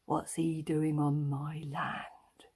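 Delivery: slow suspicious whisper; whispered thought. Acted. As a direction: hiss the thought under your breath. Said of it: "And they think".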